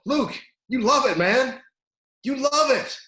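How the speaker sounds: high, loud rallying call; urging coach. Retold or imitated. Coach to a crying wrestler during sprints, urging him on.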